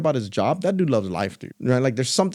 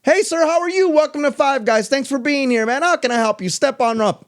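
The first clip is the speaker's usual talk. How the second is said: much higher, eager, bouncy welcome; energetic cashier; 11 semitones higher, 3 dB louder than usual. Retold or imitated. Imitating the kid's energetic greeting to a customer. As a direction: sell the welcome with huge energy. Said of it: "was his energy".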